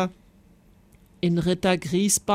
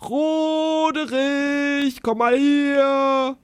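slow, high, drawn-out calling; 7 semitones higher, 4 dB louder, much slower than usual. A narrator's reading. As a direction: stretch the name out, calling far. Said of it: "brüllte der sechsköpfige Drache".

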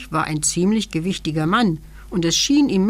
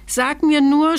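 high-pitched, alarmed king; startled stammer; 8 semitones higher, 4 dB louder than usual. A narrator's reading. King clinging on during the wild ride, startled. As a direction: blurt it out in a fright. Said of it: "stotterte der König erschrocken".

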